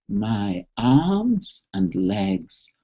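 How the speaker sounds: slow, low and soft, like a calming mantra; drawn-out soothing self-talk. Shown as a demonstration. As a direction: let each word sink in slowly. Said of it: "you say".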